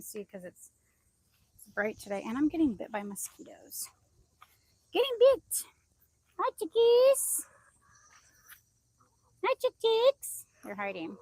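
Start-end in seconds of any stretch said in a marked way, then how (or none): 4.9-5.6 loud, squeaky-high singsong to the animals; high-pitched pet talk. Real, not acted. Talking to her chickens. 6.4-10.4 very high, loud, drawn-out pet voice; chicken-greeting coo. Real, not acted.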